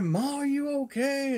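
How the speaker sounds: high-pitched call through a door. Retold or imitated. Calling to mom through door.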